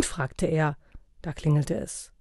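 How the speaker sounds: plain reading voice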